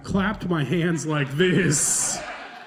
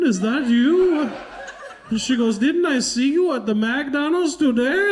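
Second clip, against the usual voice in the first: surprised cashier; 10 semitones higher, 4 dB louder than usual. Retold or imitated.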